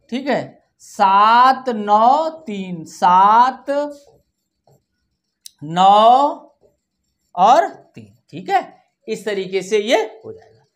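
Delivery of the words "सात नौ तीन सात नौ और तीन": loud, drawn-out digits with pauses; dictation. Shown as a demonstration. Teacher dictating last digits to children, slow dictation. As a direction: stretch each digit and wait after it.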